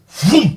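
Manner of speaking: shouted engine vroom; loud vroom. Pretend. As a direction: belt out a vroom like a revving engine. Kicking trolls out of chat.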